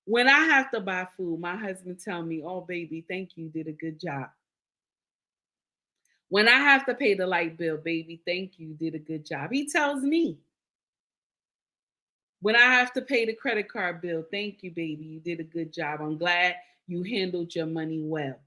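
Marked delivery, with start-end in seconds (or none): 2.4-4.3 husband's soft praise. Retold or imitated. 7.8-9.5 husband's soft praise. Retold or imitated. 14.3-18.4 husband's soft praise. Retold or imitated.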